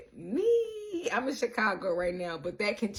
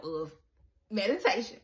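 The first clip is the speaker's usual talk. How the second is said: normal talking voice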